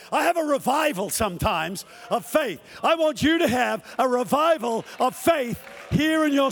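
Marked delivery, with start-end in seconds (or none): none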